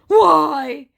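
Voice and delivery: high, loud, slow put-on scammer voice; mock scammer voice. Scammer teasing the angry narrator, high loud mock voice. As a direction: tease him, stretched out. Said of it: "he said".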